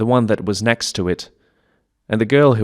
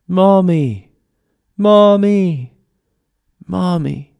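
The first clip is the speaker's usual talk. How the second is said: high calls of Mommy fading at the end; quieter calling; 9 semitones higher, 4 dB louder, much slower than usual. Retold or imitated. Child's calls to mom getting quieter, high and fading. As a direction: call again, dying away. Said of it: "but a little more quiet now".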